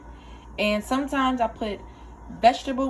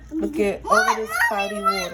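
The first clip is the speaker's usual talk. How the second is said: laughing; 12 semitones higher than usual. Real, not acted. Laughing at the murky rinse water.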